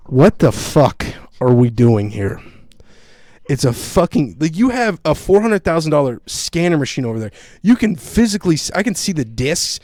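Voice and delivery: loud, exasperated rant; pissed off. Retold or imitated. Passenger watching officer smash his bag, loudly exasperated. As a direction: protest with rising pitch and volume. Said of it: "that pissed me off even more".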